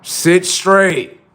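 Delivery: sharp, forceful command; stern command. Acted. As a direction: order a stubborn kid to obey. Strict self giving orders.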